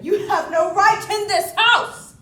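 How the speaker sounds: loud shout to get out; furious order. Acted. Ordering the intruding woman out of the house, furious shout. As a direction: throw her out with a yell.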